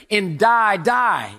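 loud stressed double die; punchy preacher's shout; emphatic shout. Shown as a demonstration. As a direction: ring out each die like a sentence. Reading the Hebrew curse.

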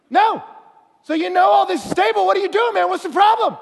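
high, loud mock exasperation; hammed-up incredulous shouting; exasperated shout. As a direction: yell at a stalling climber to get on with it. Exasperated retort to panicking climber.

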